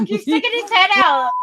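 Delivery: high, excited pointing; excited coo. Real, not acted. Showing a friend the snake video, a high excited coo. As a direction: coo over the cute snake.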